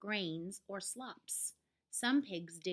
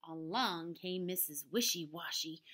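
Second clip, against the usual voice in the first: ordinary delivery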